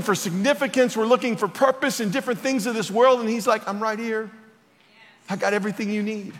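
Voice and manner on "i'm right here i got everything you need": tender reassurance. Acted. Jesus gently speaking to seekers.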